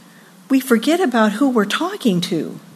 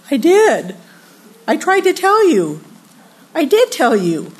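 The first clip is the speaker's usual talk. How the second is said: insistent; 7 semitones higher than usual. Acted. Voices God answering back.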